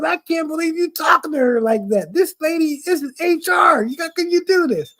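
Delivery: high, disbelieving voices; shocked coworkers. Retold or imitated. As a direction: gasp at his nerve. Coworkers shocked at his talk.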